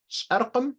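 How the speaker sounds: "sharqam" with r and qim sounded, pitched high; fuller form. Shown as a demonstration. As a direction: offer "sharqam" high, keeping every consonant. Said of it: "you can say".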